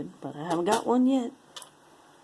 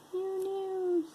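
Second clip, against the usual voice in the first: high falsetto "who knows"; playful high-pitched repeat; silly high voice; 14 semitones higher than usual. Pretend. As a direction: toss it off in a squeaky voice.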